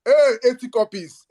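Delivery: rally the crowd with a thrilled call; excited call. Real, not acted. Hyping live book sales to viewers.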